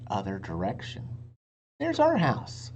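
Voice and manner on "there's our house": high, louder glad cry; Annie delighted. A narrator's reading. Annie points out their house to Jack, high and glad. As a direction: spot home with joy. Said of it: "she said".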